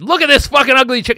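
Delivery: high, loud jeering yell; mock shout. Secret police spotting an ugly woman, loud jeering yell. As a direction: jeer at her loudly. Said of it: "They went".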